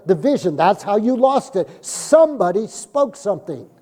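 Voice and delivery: loud, emphatic sermon delivery; forceful preaching. Real, not acted. Preacher confronting those who lost the vision, forceful preaching. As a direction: drive it home to the congregation, loud.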